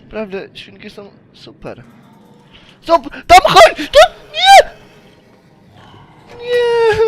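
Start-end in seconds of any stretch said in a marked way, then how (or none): 2.8-7.0 very high, loud screaming; terrified screams. Real, not acted. Gamer screaming as the pig chases him, terrified. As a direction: scream in panic.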